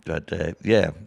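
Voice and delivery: usual speaking voice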